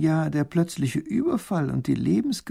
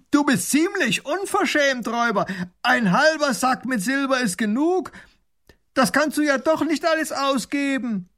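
high, loud call through cupped hands; calling across; 13 semitones higher, 4 dB louder than usual. Acted. Baron calls over the river to the robber, indignant and loud. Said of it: "legt die Hände als Trichter an den Mund und ruft hinüber".

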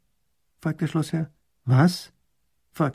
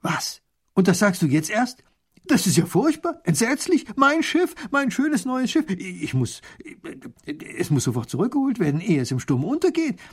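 higher, dismayed cry over the lost ship; a small ruler wailing for his new ship; distraught little grand duke; 8 semitones higher than usual. Acted. Little grand duke hears bad news.

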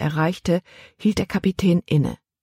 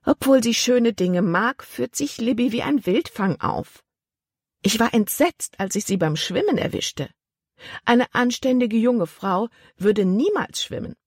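higher, scandalised aunt voice; indignant aunt; 7 semitones higher than usual. A narrator's reading. Aunt telling the captain about Libby swimming, higher scandalised voice. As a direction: pitch up and sound shocked like a prim aunt. Aunt's voice held.

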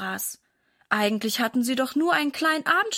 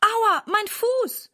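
pained wail; 11 semitones higher, much slower than usual. Acted. Mother twists her ankle.

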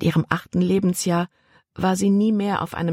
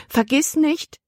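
a high, prim aunt warning; mock-stern reminder, lightly voiced; aunt warning her niece, lightly; aunt's warning; 11 semitones higher, slower than usual. A narrator's reading.

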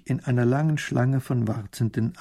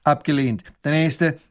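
raised, official refusal; curt dismissal; 3 semitones higher, 4 dB louder than usual. Acted. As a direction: dismiss the petitioner coldly. Minister waves widow away. (knapp und abweisend)

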